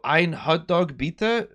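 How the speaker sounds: "Hot Dog" with a clearly sounded H; drawn-out "bitte" rising at the end; careful German request. Retold or imitated. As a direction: slowly pronounce "Hot Dog" with the H, questioning. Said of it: "which is very different".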